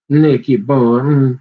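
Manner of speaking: drawn-out call. Acted. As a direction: savour each word as you call it out. Same words repeated, drawn out.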